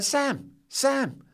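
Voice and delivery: put-on high girlish voices calling; girls calling up. Netball girls calling up to the boy on the fence, high and girlish. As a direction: chirp up at him like schoolgirls. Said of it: "they're stopping and going".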